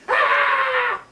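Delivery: sharp high yelp of pain. Retold or imitated. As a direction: yelp out loud as it bites. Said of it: "I let out a yelp".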